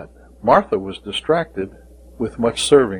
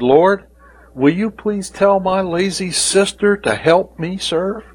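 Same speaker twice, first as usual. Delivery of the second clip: exasperated Martha; 7 semitones higher than usual. Acted. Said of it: "Basically paraphrasing, it said".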